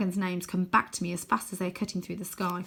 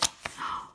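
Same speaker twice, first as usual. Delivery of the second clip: soft gasp; 8 dB quieter than usual. Real, not acted. Reader reacts to picture.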